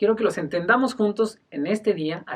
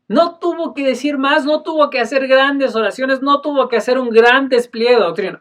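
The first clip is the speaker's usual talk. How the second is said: higher, louder preaching build; preaching at full force; 6 semitones higher, 7 dB louder than usual. Real, not acted. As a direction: drive the point home from the pulpit. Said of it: "preaching climax". (predicación con fuerza creciente)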